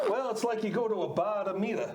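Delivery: hammed-up Australian accent; Aussie accent. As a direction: play it as a comic Aussie. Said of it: "Give us an Aussie accent".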